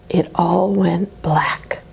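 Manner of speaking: slow whisper, fading out; ominous hush as the lights go out; dramatic hushed fade. Acted. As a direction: let it drop to a slow whisper.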